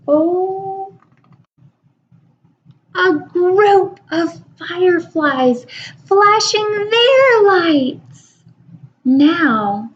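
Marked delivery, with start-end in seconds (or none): 0.1-8.4 high, slow amazed reveal; gasping wonder. A narrator's reading. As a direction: gasp and marvel at the fireflies.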